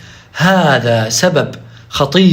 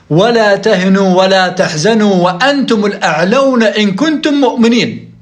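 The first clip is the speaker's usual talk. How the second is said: Quran recitation; 2 semitones higher than usual. Acted. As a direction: intone the verse like a reciter in the mosque. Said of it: "الله سبحانه وتعالى ماذا يقول؟". (تلاوة قرآنية مجودة)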